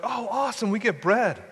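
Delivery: high, bright burst of delight; excited little brother. Retold or imitated. Preacher imitates his brother cheering when the bread arrives, high and excited. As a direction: gush over the bread like a happy kid. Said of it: "my brother would be like".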